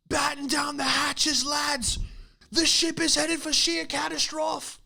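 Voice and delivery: high, slow, far-off sea captain bellowing orders; ship captain shout. Acted. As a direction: roar it over the storm like an old sea captain. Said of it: "Like a shipwreck scene in a movie".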